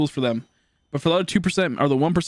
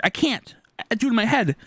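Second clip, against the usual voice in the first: exasperated protest; 8 semitones higher than usual. Retold or imitated. Retold argument with math teachers.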